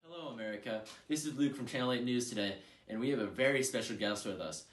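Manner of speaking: usual speaking voice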